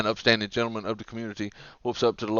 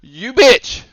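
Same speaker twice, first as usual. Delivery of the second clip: high, loud mock outrage; berating car thief; 10 semitones higher, 10 dB louder than usual. Car thief berating the mother, mock furious yell. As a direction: lay into her, hammed-up. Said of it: "gives her a serious ration of shit".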